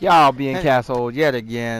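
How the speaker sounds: loud, slowed-down YouTube opener; booming streamer welcome to viewers; hype intro. Acted. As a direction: shout the channel intro to the world. Channel intro greeting viewers.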